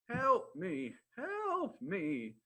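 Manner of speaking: high, loud storybook cry for help. Acted. Lion tangled in the hunters' net, crying out. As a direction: call out in hammed-up distress. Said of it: "the lion cries out".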